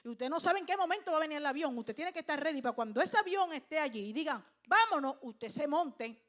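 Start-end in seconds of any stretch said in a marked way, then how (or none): 4.7-5.2 loud, raised boarding call; sudden acted call. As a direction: call everyone to move now.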